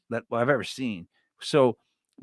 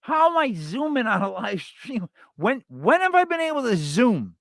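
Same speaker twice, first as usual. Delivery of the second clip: mock outrage; 8 semitones higher than usual. Real, not acted. Mock outrage at zoom request.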